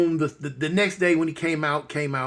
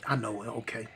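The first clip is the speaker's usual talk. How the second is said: soft reply; 7 semitones lower, 11 dB quieter than usual. Retold or imitated. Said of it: "I'm like".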